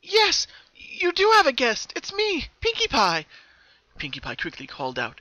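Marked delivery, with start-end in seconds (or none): 0.0-3.3 high, loud eager call; excitedly. Acted. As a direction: call out, thrilled to find a friend.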